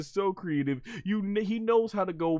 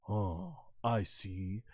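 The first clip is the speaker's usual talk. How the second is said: low calm realization; 8 semitones lower, 9 dB quieter, much slower than usual. Retold or imitated. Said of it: "he's like".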